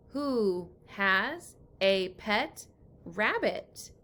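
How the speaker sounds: put-on slow, word-by-word reading for young readers; teacher modelling a sentence slowly; slow read-aloud. Shown as a demonstration. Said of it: "reads sentence aloud for class".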